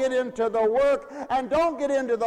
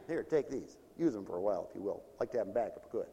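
much lower, softer grudging offer; a hint of a reluctant church member; stingy giver; 13 semitones lower, 11 dB quieter, much faster than usual. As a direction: mumble it like you want them back. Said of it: "did you just throw in a foot and a hand".

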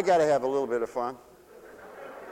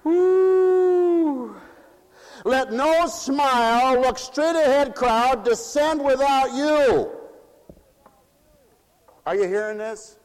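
loud, high, slow preaching; fiery preaching; 13 semitones higher, 7 dB louder, much slower than usual. Acted. Preacher urging the church to let the sulkers descend, loud and slow. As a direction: thunder it out to the crowd.

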